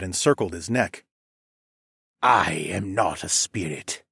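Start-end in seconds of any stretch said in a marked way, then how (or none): 2.2-4.0 low raspy voice. A narrator's reading. Villain dragon's reply.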